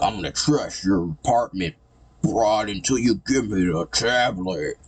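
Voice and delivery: hammed-up thug voice, slowing as it builds; villain threat. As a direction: threaten like a cartoon heavy, stretching the end.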